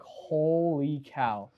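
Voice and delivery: drawn-out retold amazement; amazed. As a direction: stretch the words slowly in awe.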